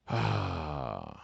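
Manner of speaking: long, low sigh. Real, not acted. Host winding down after the story, long sigh. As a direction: let out a slow soft breath.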